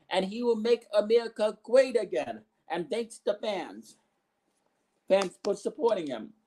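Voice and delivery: ordinary talk, nothing marked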